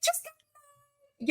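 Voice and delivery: hammed-up high singsong hello; mocking a livestreamer. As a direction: parody a clueless person going live. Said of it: "they go".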